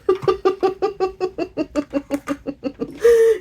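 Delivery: long high laughing fit; high breathless laughing. Real, not acted. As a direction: let a long laugh roll. Laughing at her son.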